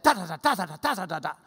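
DAs in quick drumming bursts; rat-a-tat rhythm. Shown as a demonstration. Teacher sounds out the Greek formula, rat-a-tat. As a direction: patter the syllables like a speed bag. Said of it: "rat-a-tat formula".

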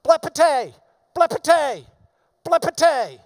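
blepete said three times, each loud, high and falling; each blepete punched hard; triple repetition. Shown as a demonstration. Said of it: "uses the word three times".

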